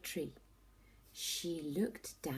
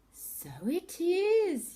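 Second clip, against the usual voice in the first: slow, high yawned words; yawning; 12 semitones higher, 9 dB louder, much slower than usual. A narrator's reading. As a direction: stretch it out in a big yawn. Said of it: "yawned Missus Tortoise".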